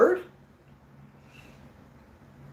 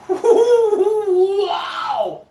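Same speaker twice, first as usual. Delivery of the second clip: high, loud, long-drawn wow; real blown-away holler; amazed whoop; 13 semitones higher, 5 dB louder than usual. Real, not acted. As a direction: whoop it out, stretching it wide. Recalling wild trip to Mexico.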